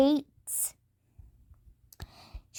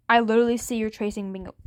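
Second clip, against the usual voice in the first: usual speaking voice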